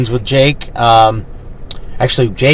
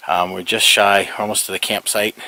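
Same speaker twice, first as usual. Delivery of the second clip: normal talking voice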